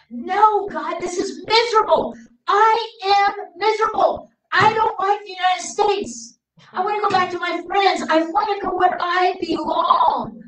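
put-on teenage girl protesting to God at the altar; whiny teen protest. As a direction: plead like a homesick teenager.

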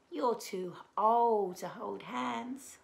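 ordinary delivery

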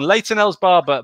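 ordinary talk

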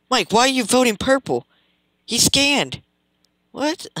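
high, loud disbelief; can't believe the vote, shouting; incredulous outburst. Real, not acted. Shouting at teammate's wrong vote.

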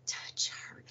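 stunned breathy whisper; hushed stunned whisper; whispered disbelief. Retold or imitated. Stunned aside.

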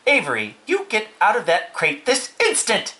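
high, emphatic scolding; commanding mother. A narrator's reading. Mother ordering her son out, stern command. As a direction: order a child out sharply. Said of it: "commanded his mother".

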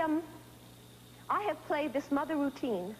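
ordinary talk, nothing marked

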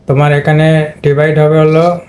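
ordinary talk

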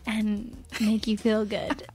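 slow, soft sultry voice; breathy seductive drawl, slowed down; sexy voice. Pretend. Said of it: "Let's do our sexy All right".

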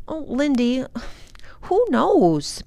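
mild exasperated sigh. A narrator's reading. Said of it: "Ben sighed".

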